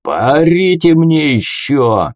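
high, drawn-out roar; slow, stretched warning yell; bellowed threat. A narrator's reading. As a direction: roar down an unruly crowd. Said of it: "заорал Флосси".

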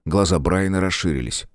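plain reading voice

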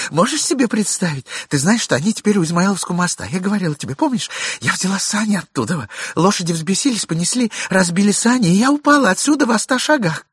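high, rapid gushing story; breathless chatter. Acted. Wife explains herself to her husband, gushing. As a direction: pour out the excuse quickly.

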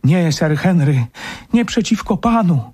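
high, imploring female character; alarmed wife pleading; woman's voice. Acted. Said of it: "odezwał się nagle głos kobiecy".

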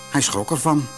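plain reading voice

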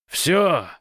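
panicked howl, high and loud; hysterical wail. A narrator's reading. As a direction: wail it out in despair. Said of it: "истерически взвыл Гош".

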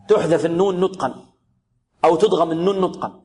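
ordinary delivery

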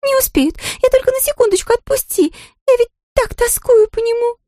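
high, whining plea to be let go; whiny dragon girl. Acted. Dragon girl whines to Anfisa to be released. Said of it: "разнылась драконица".

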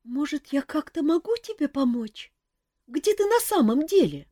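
high, small scared chick voice; frightened peep. A narrator's reading. Chick peeks from the sleeve, high frightened squeak. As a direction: squeak it timidly. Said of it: "испуганно пропищал".

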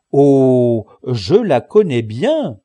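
loud, drawn-out, knowing exclamation; loud recognition. A narrator's reading. Sancho tells Don Quichotte he knows the girl, loud and knowing. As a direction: draw it out loudly, full of recognition.

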